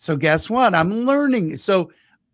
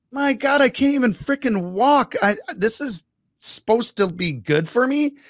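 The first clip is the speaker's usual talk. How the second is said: higher, whiny groan of pain; exaggerated complaining; 7 semitones higher than usual. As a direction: ham up the aching body. Voicing sore self getting up.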